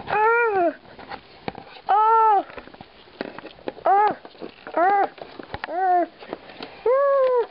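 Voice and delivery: high play screams; repeated yells of pretend terror. Pretend monster attack.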